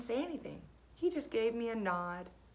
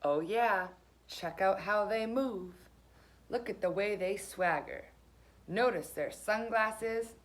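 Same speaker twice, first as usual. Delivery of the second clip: ordinary delivery, nothing marked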